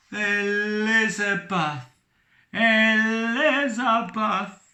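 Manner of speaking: slow, high and loud anguished call; anguished cry. Acted. Captain crying for his lost love, slow. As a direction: cry her name in anguish. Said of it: "cried Captain Brazil in anguish".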